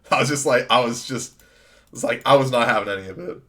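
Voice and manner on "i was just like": usual speaking voice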